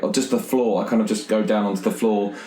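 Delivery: usual speaking voice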